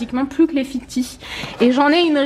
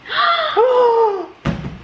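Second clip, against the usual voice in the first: shocked gasp; 10 semitones higher than usual. Real, not acted.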